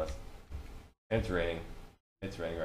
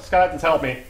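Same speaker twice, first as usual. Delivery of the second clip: ordinary talk, nothing marked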